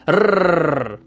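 "rrrr" trilled long on the tongue; rolled R. Shown as a demonstration. Teacher demoing a long trilled Spanish R. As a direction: roll the R hard. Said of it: "A Spanish R sounds like".

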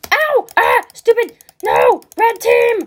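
high, loud bursts under attack; panicked yelling. Real, not acted. Gamer attacked by red team, panicked high yelling. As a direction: yell each word in alarm.

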